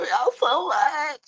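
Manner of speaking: slow, drawn-out lines from a worn-out voice; straining to get words out after the voice ran off; voice gone. Real, not acted. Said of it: "I'm whispering now 'cause my voice gone".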